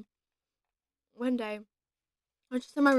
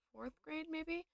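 usual speaking voice

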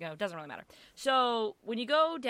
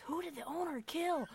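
acted shocked bystanders; 8 semitones higher than usual. Shocked shoppers react to the accusation.

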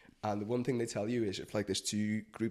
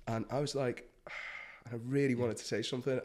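ordinary talk

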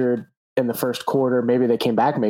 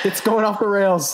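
laughing; 9 semitones higher than usual. Real, not acted. Laughing at the silliness.

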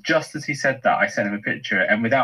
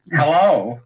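a louder, bouncy American hello; American accent; 3 semitones higher, 6 dB louder than usual. Shown as a demonstration. [name] greets the podcast listeners, in an American accent. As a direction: greet them like a cheerful American. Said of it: "I do an American accent".

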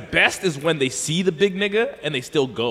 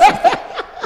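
very high squealing laugh; 3 dB louder than usual. Real, not acted. Friend laughs recalling Stanley's fight, high squeal. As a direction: burst into a shrieking giggle.

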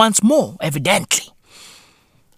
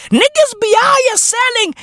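much higher mock shout; calling out. Hailing Xi Jinping on arrival, in a high mock shout. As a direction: hail him across the street.

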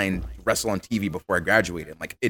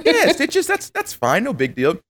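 feigned composure; 3 dB louder, slower than usual. Retold or imitated. Retold feigned calm before stitches.

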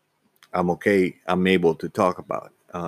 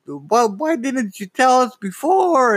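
imitating mom, much higher and slow; mom's eager question. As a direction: ask like a delighted mother, voice pitched up. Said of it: "she gets all happy".

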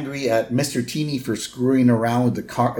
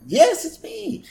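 very high put-on cartoon voice; Chief Wiggum; slower than usual. Police chief telling Homer what to say, high mock voice. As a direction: squeal it high, mock prompting. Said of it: "Wiggum's like".

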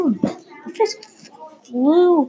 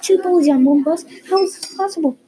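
high, louder disbelief; inward scream; 7 semitones higher, 4 dB louder than usual. A narrator's reading. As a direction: exclaim it as a screamed thought. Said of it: "he screamed in his head".

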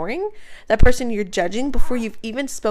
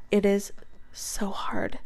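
hushed whisper, slow; 8 dB quieter, slower than usual. Real, not acted. Speaker whispering to listeners about how hard love is. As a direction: whisper it like a confession.

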